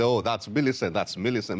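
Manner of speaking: ordinary delivery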